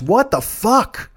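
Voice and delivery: slow, high exasperated; drawn-out frustrated groan. Real, not acted. Frustrated at own slip.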